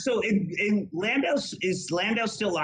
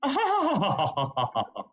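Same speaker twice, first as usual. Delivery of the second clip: imitated fake-sounding laugh that trails downward; Ann Coulter laugh. Shown as a demonstration. Guest demonstrating how her friend laughs, imitation laugh. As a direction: do a drawn-out impression of her laugh. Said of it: "I'll do it one more time".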